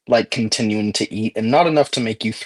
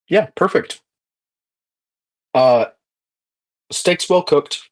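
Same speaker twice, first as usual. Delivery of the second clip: high sing-song food review; high cheerful voice; 6 semitones higher than usual. Acted. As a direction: chirp it like a happy taster. GM voicing the taste again.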